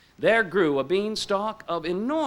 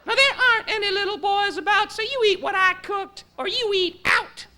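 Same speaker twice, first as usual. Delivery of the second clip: shrill it out like a bossy wife scolding her husband; wife scolding the giant over his supper; scolding giant's wife; faster than usual. Acted. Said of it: "But the giant's wife said".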